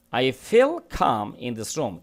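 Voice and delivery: each word read out slowly and clearly; slow model reading. Shown as a demonstration. Teacher reading the sentence slowly to students. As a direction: read it slowly for the class to follow. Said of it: "teacher reads model sentence slowly".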